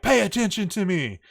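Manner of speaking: imitated indignant command; demanding stripper. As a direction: loudly demand notice, acted out. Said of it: "and is like".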